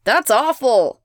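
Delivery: loud, shocked little yelp. A narrator's reading. Someone behind Elena reacts, yelping in shock. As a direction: yelp it from the crowd. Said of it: "yelps someone behind Elena".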